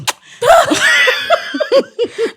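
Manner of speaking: loud, high burst of laughter; real laughing fit; helpless laugh. Real, not acted. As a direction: crack up suddenly. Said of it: "sorry for laughing".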